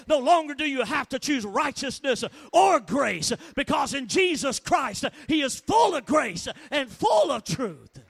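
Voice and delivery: loud, driving pulpit delivery; impassioned, shouted sermon cadence; full-force preaching. Real, not acted. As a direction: hammer each phrase out to the congregation.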